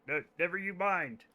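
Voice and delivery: ordinary delivery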